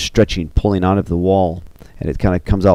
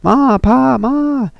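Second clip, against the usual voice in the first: high, long calls to parents; acted high-pitched cry for parents; calling out; 3 dB louder, much slower than usual. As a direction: call out to someone who cannot hear you. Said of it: "She's calling for the parents".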